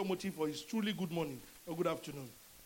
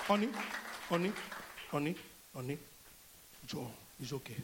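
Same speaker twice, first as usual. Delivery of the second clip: coaxing honey; 5 semitones lower than usual. Retold or imitated. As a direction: sweet-talk her gently, honey after honey. Husband coaxing demon out of wife.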